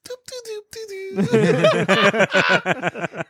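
high, airy mock singsong; high doop-doop nonsense singing; cutesy persona. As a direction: play her sweet public persona. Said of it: "the public persona that she's putting out".

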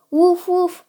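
high answering woofs; dog's bark. A narrator's reading. Lalo replying to his doggy friend, high barking. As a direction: bark back like a friendly dog. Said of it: "replied Lalo".

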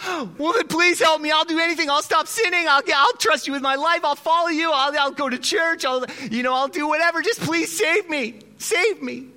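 high, loud frantic begging; man hanging from a root begging God to save him; desperate pleading. Acted. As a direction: beg for rescue, terrified. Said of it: "And Freddie says".

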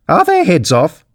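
high-pitched queenly shout. A narrator's reading. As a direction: call out the question imperiously. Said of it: "shouted the Queen".

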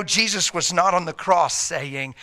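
ordinary delivery

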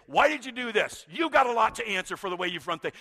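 loud indignant challenge; demanding. Acted. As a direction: confront God with a grievance. Imagined demand to God.

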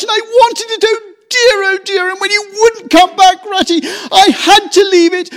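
high, loud tearful outpouring; sobbing lament. A narrator's reading. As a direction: pour out the grief through sobs. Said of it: "he sobbed at last, brokenly".

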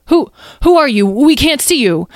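high, loud, stammered call. A narrator's reading. Azriel calls to the unseen voice, calling out. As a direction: call to someone hidden, rattled. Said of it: "Azriel called out".

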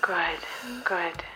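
slow, soft reassuring coach; calm soothing. Acted. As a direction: guide her breath gently. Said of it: "take a deep breath together".

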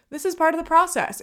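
bright forced optimism, a touch put on; mock upbeat self-pep talk. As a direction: cheer yourself up like a coach. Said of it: "list of things that my brain thought".

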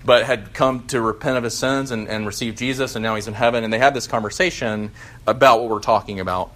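ordinary talk, nothing marked

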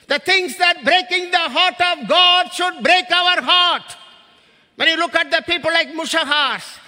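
pastor urging the church to care; preaching. Acted. Preacher pleading about God's heart.

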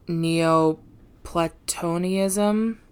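Neoplatonism spelled out very slowly, syllable by syllable; careful pronunciation. Shown as a demonstration. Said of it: "Yes, I said that correct".

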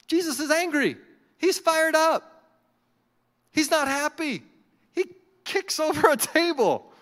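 high, loud, punched-out phrases; fired-up preaching. Real, not acted. Preacher telling how Jesus got angry, fired-up preaching. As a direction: build excitedly to the table kick.

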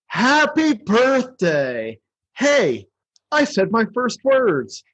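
high, slow, wide-eyed snowman just come alive; high snowman voice, drawn out; Frosty quote. Retold or imitated. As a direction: squeal it out in wonder, slowly. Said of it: "he's like".

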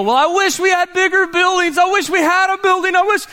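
high whiny mock wishing; whining churchgoer. Churchgoer wishing for buildings, high mock whine. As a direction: pitch it up like a wistful complainer. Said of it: "Some of you go".

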